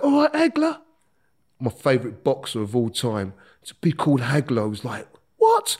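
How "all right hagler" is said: much higher call across the landing; shouted nickname. Retold or imitated. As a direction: call out high to him as he passes. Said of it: "someone shouts out".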